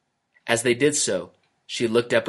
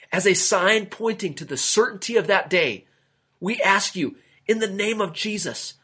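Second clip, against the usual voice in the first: higher, earnest prayer voice; 7 semitones higher than usual. A narrator's reading. Peter prays over the dead snake, earnest prayer. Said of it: "Then Peter prayed".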